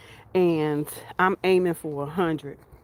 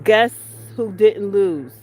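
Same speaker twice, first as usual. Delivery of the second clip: triumphant boast; 2 semitones higher, 9 dB louder, slower than usual. Real, not acted. Triumphant boast about her vegetables.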